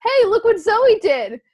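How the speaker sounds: high, loud mimicked tattletale call; tattling shout. Safi tells mom about the piercing, mimicked loud tattling. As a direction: imitate a kid loudly telling on someone, drawn out.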